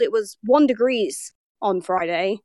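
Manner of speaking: ordinary talk, nothing marked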